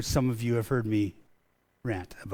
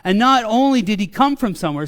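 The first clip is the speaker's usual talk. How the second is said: louder, higher preaching rant; rising rant; 7 semitones higher, 6 dB louder than usual. Real, not acted. Pastor ranting to the congregation about begotten, louder and higher. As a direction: build it up, preaching with fire. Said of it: "this is where my rant starts".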